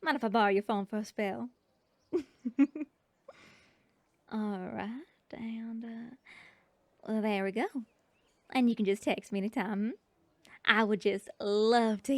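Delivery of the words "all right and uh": murmured under the breath; whispered. Acted. Honey seller entering her number, whispered. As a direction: whisper while busy with the phone.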